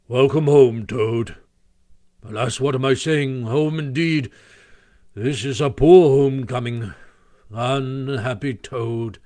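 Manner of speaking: solemn, weighty Badger greeting; serious and portentous. A narrator's reading. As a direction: intone it like a grave pronouncement. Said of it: "very serious and portentous style of greeting".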